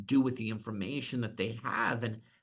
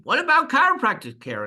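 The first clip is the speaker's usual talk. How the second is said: much higher, louder whiny question; employee's high, loud mock protest; mock complaining employee; 13 semitones higher, 7 dB louder than usual. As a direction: play the upset employee asking.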